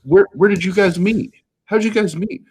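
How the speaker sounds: very high, mock-eager questioning; eager imitation. Church friends asking the engaged couple, mock-eager and high.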